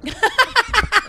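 high laughter. Real, not acted. Laughing at rustling.

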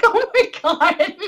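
retold high, drawn-out gasp of awe; overwhelmed. Fan answering heartfelt thanks, overwhelmed. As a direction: imitate yourself overwhelmed, breaking into a laugh.

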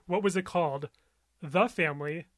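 ordinary talk

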